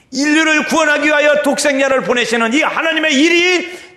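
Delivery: high, loud proclamation; full-force preaching. Acted. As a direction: declare it at the top of the pulpit voice.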